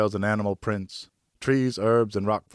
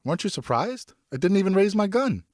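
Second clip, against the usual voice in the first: put-on young son voice; 11 semitones higher than usual.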